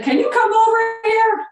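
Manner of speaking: high shouted yeah down the phone; deaf old man screaming. Retold or imitated. Deaf old owner answering his phone, shouting. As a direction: yell it like a deaf old man who can't hear himself. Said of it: "He was screaming".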